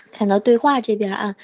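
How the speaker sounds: ordinary talk, nothing marked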